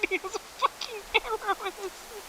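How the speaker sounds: cracking up as you say it again; laughing. Real, not acted. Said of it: "And he has a fucking arrow in his knee".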